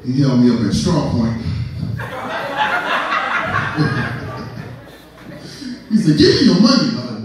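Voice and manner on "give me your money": higher, forceful African-cabdriver demand; mock angry robber. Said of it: "He was so mad he robbed me".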